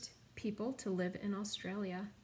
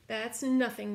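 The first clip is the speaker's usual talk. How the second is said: ordinary delivery, nothing marked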